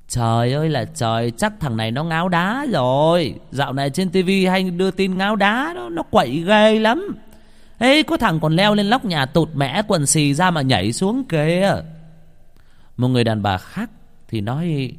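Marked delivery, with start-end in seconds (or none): 0.1-11.8 gossipy woman. A narrator's reading. Gossipy woman telling onlookers he is on drugs, high-pitched. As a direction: exclaim shrilly like a village gossip. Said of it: "Có người đàn bà dầu mỏ phán".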